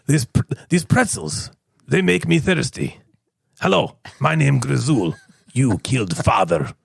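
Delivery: slow, low attempted Russian accent; Russian accent, weighty and unhurried. Shown as a demonstration. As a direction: do a halting, deliberate Russian accent. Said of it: "Russian if possible".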